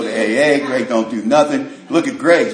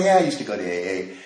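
cocky; 6 semitones lower, 5 dB quieter than usual. Retold or imitated. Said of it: "this cockiness and arrogance about him".

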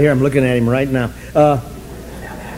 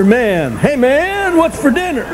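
high, drawn-out hippie "hey man"; lazy teenage stoner calling to his mom; stoned hippie son; 9 semitones higher, slower than usual. Retold or imitated. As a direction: son asking his mother about dinner, lazy hippie voice. Hippie son calls to mother.

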